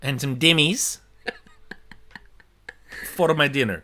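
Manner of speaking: slow, hammed-up Italian accent; mock Italian accent. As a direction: put on a thick fake Italian voice. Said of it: "Italians will be like".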